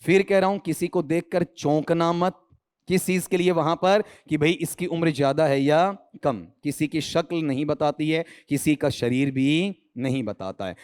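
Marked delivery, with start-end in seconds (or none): none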